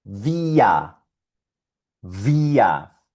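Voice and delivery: vía as German wir, R turned to a. Shown as a demonstration. Teacher modeling German wir. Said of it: "Y esto suena".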